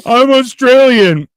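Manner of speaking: high, loud, slow mocking voice; mock whiny claim. Mocking Bodie's claim to be Australian, high whine. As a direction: whine it like a liar caught out. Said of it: "No, you're not".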